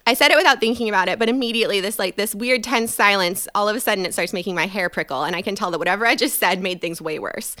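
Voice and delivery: ordinary talk, nothing marked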